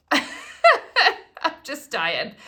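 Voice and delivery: slow, laugh-filled and giddy; laughing, overjoyed. Real, not acted. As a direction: melt into laughter from sheer delight. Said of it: "I'm so excited I can't stand it".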